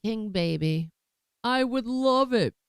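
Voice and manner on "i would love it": taunting Trump. Retold or imitated. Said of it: "Trump responded, taunting the judge".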